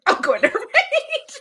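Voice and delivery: high, slow, giggling threat; laughing mock rage. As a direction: laugh through a playful rage line. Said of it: "Sydney was like".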